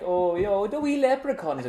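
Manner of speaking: hammed-up Irish lilt; Irish accent, light and playful. Shown as a demonstration. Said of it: "sort of this one you, you might expect".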